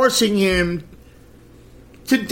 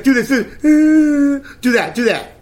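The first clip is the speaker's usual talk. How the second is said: imitating a mother faking a sob, higher pitch; mock fake-crying mother; 5 semitones higher than usual. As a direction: mimic her put-on whimper as she coaches the kid. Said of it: "She's like".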